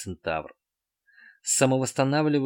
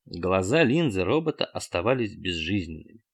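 ordinary delivery

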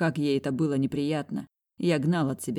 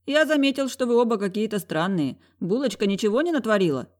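frowning; 7 semitones higher, 3 dB louder than usual. A narrator's reading. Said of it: "нахмурился он".

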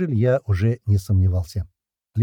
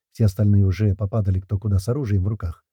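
usual speaking voice

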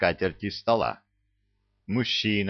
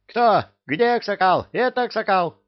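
very high, loud yell; shrieking; 13 semitones higher, 5 dB louder than usual. A narrator's reading. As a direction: scream it out in alarm. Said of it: "завопил попугай".

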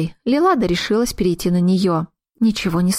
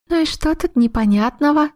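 surprised; 7 semitones higher than usual. A narrator's reading. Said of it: "удивилась Хондрия".

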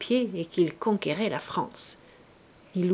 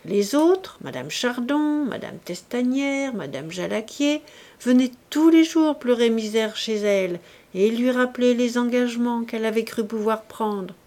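ordinary delivery, nothing marked

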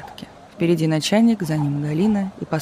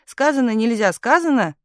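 climbing, higher-pitched insistence; crying out; 6 semitones higher than usual. A narrator's reading. Telegraph operator insisting it is forbidden, crying out. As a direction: insist with rising agitation. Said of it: "кричала она".

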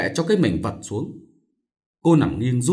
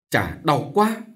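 higher, pained little moan; 4 semitones higher, much slower than usual. A narrator's reading. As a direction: wince through the words. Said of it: "Cô suýt xoa". (suýt xoa đau đớn)

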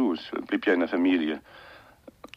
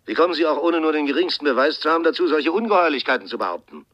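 louder, higher, indignant outburst; furious; 4 semitones higher, 5 dB louder than usual. Acted. Brother on the phone, furious at the accusation of murder. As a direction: blast him for his insolence.

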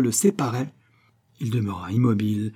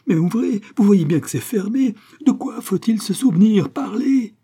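high, loud hysterical pleading; frantic, desperate; 11 semitones higher, 6 dB louder than usual. A narrator's reading. Malouin begging the dying man to open, frantic. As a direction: beg and demand in total panic. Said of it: "sanglota, trépigna".

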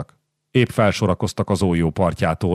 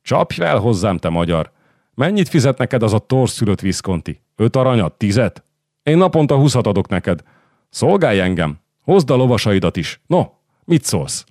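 higher, lively recruiting pitch; boastful condottiere; 4 semitones higher, 3 dB louder than usual. Acted. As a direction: tempt him like a swaggering commander. Voices Carmagnola recruiting his rival.

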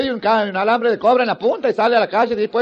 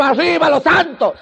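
fan cheer; 5 semitones higher, slower than usual. Retold or imitated.

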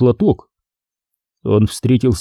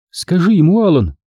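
a higher, urgent "tell him, Alan"; fervent plea; 7 semitones higher than usual. A narrator's reading. Pat urging Alan to tell the truth, fervently pleading. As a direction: press him earnestly. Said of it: "горячо попросила она".

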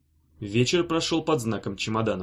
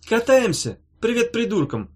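sour; 10 semitones higher, 4 dB louder than usual. A narrator's reading. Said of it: "сказала она кисло".

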